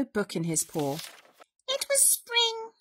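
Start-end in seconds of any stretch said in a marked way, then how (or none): 1.7-2.7 high, sing-song mouse voice; high little storybook reader; mouse reading aloud. A narrator's reading. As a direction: read a sunny book as a tiny mouse. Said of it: "Mouse read".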